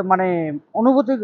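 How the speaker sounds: ordinary talk, nothing marked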